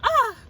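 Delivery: high, loud fangirl yelp; sudden high-pitched burst of "ah"; excited squeal. Real, not acted. Fan overcome while gushing about the rapper, high loud squeal.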